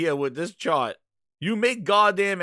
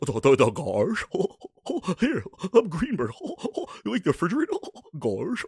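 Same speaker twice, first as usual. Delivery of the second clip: dopey cartoon dog voice with guffaws; goofy chuckles between halting words; Greenberg as Goofy. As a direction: play a bumbling, hiccuping cartoon sidekick. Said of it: "here comes Greenberg".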